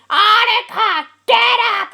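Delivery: loud, high wake-up yell; parents yelling. Retold or imitated. Parents yelling at her to get out of bed, loud high call. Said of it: "yelling at me to get up".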